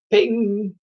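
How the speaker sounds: high, ringing ping; ping it like a small object bouncing off; high-pitched cartoon ping; sound-effect word. A narrator's reading. Tooth hitting a head, sound effect.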